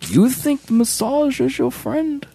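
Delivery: slow, put-on Bane villain voice; slow menacing masked-villain voice. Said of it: "but then you just went with Bane".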